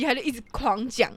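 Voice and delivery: ordinary delivery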